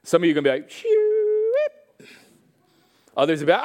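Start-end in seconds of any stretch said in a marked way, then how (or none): 0.7-2.1 very high, drawn-out squeal; comic high whoosh; imitated zipping-away noise. Listener dodges sharing with strangers, comic high squeal. Imitates someone fleeing the challenge.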